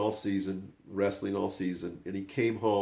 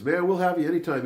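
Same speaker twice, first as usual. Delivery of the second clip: higher, louder, keen coach; eager welcome; 9 semitones higher, 7 dB louder than usual. Retold or imitated. Coach telling Brandon he can join anytime, eager welcome. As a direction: welcome him eagerly, voice lifted.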